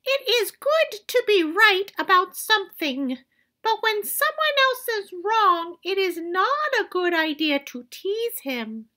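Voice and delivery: put-on high, gentle old grandmother voice; high grandmother giving advice; wise grandmother. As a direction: advise kindly like a wise granny.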